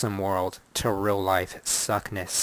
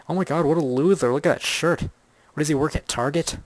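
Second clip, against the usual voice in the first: higher, louder sneering heckler; a catty critic mocking his shirt; mocking snob voice; 5 semitones higher, 4 dB louder than usual. Imagined snobby heckler.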